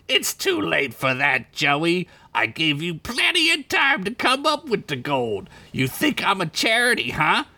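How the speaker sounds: high, sarcastic crime boss; mock him with a squeaky sneer; sneering Crinkles. Crinkles lays into Joey.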